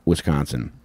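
ordinary delivery

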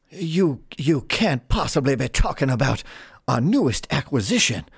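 incredulous circus boss, stammering at first; flustered ringmaster. Acted. Ringmaster asked about his star act, incredulous.

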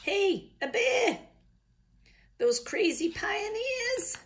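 put-on high, loud, drawn-out holler in an Australian accent; excited calling out. Pioneers spotting a supposed bear, high Australian-accented holler. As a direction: call it out high and stretch the words.

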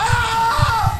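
sudden high loud scream; real fright scream; startled scream. Real, not acted. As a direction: shriek at the jump scare. Jump scare in horror game.

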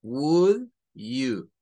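would you, said slowly and clearly; English model phrase. Shown as a demonstration. Teacher models Would you for students, slow and clear. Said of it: "Una de ellas es would you".

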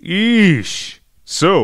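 ordinary talk, nothing marked

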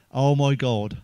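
ordinary talk, nothing marked

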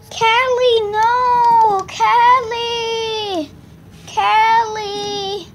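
calling out. Pretend. Calling runaway dog.

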